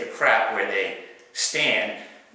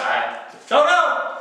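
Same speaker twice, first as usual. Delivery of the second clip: panicked answer; 13 semitones higher, 3 dB louder, much slower than usual. Retold or imitated. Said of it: "I said".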